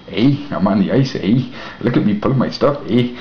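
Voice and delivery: mock Canadian accent, eh-ing at each line. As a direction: play a proud Canadian ice trucker showing off. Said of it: "See, I can talk Canadianese".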